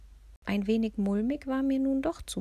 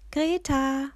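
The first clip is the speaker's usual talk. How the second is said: raised, high call of a name; lautes Rufen; 7 semitones higher, 5 dB louder than usual. A narrator's reading. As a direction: call out to a friend across a crowd. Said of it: "„Nicht so laut". (laut und hoch gerufen)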